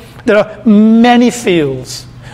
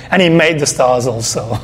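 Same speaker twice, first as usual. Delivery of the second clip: offhand throwaway; 7 semitones lower than usual. Shown as a demonstration. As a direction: say it like it hardly matters. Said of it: "Like, like it's insignificant".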